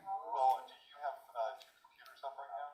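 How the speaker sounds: very faint distant echo; audio echo. Real, not acted. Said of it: "Can you hear the echo?".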